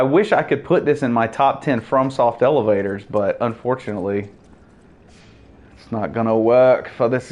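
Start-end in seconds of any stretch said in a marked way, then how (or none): none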